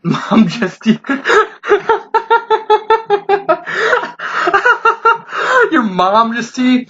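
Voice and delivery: cracking up mid-line; giggling as the slip lands; breaking into real laughter; laughing through the words. Real, not acted. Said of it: "Wow, way to go, Josh".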